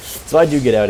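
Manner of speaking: surprised laugh. Real, not acted. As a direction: laugh out the words. Surprised he survived the fight.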